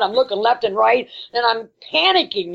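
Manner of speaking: normal talking voice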